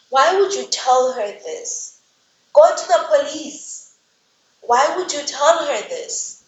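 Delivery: loud, high-pitched, drawn-out exasperation; exasperated scolding. Real, not acted. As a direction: berate a foolish character, stretching the words in disbelief. Narrator exasperated, berating the story's character.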